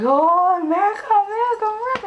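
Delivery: very high, loud squealed chorus; mock awestruck squeal; imitated excited amazement. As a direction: mimic thrilled girls squealing the word. Imitating girls' amazed reaction.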